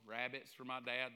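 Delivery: normal talking voice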